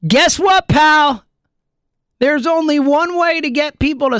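angry shout. Real, not acted. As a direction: yell it right at him, fed up. Ranting at Nola.

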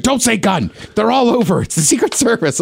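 high, urgent imitation of a worried dad; alarmed warning. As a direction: warn a kid off, in mock alarm. Dad warns kid near security.

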